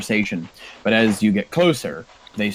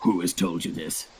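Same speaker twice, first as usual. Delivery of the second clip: low-key, softer scowling half-orc; 3 semitones lower, 7 dB quieter than usual. Acted. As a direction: demand it quietly with a scowl. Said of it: "half-orc just scowls".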